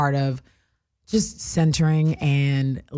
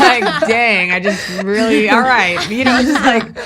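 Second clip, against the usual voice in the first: loud, high laughing complaint; laughing exasperation; 4 semitones higher, 8 dB louder, slower than usual. Real, not acted. Friend on a podcast, laughing about waking up to too much, loud and high. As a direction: laugh it out, overwhelmed.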